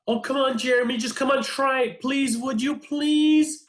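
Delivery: high-pitched, whining retold plea; pleading mom. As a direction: beg a stubborn kid, whining. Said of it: "she's pleading with him".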